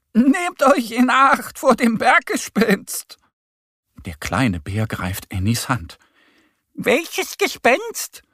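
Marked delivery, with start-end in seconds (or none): 0.1-3.2 high, loud shouted warning; called-out warning. A narrator's reading. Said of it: "ruft ihnen zu". 6.7-8.2 very high, drawn-out duck call; rufende kleine Ente. A narrator's reading. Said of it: "ruft Annie der Gemse hinterher".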